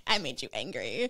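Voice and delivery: low, hushed mischievous skull voice; soft, low needling taunt; teasing skull. Acted. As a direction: gloat quietly in a put-on voice. Acting the gleeful, needling skull.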